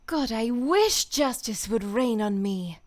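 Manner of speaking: voicing Mercy for a recorded take, slow and earnest. Shown as a demonstration. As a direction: give it slow, weighty yearning as the healer. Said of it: "let's bring up some Mercy voice lines".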